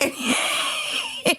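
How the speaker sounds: soft, high wheezing cackle; high wheezing laugh. Real, not acted. Telling the park story, high helpless wheezing laugh.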